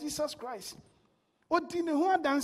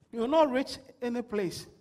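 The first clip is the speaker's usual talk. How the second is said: usual speaking voice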